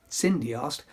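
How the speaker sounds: plain reading voice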